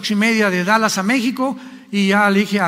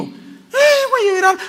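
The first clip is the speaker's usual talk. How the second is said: much higher, whimpering mock lament; mock whiny wail; 12 semitones higher, 3 dB louder, slower than usual. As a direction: play a distraught mother about to cry, hammed up. Mocks a mother whose son leaves. (madre exagerada, lamento burlón muy agudo)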